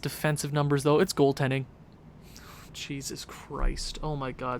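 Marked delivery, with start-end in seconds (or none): 2.7-4.6 muttered under the breath, slow and soft; soft disbelieving groan at the screen; sinking quiet despair as the score comes in; quiet dismay. Real, not acted.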